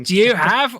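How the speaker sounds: put-on Australian accent. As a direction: attempt an Australian accent, then give up. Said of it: "read it in Australian accent".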